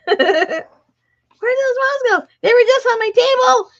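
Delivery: high loud laughing exasperation; exasperated laughing. Real, not acted. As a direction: laugh out the question. Lost moths again, laughing.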